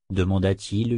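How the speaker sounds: plain reading voice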